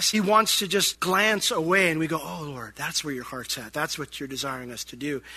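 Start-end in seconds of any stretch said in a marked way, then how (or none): 2.2-5.2 low, soft voice of dawning understanding; hushed realization. Acted. Believer responding to the Lord's glance, hushed realization. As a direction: answer quietly, low and reverent.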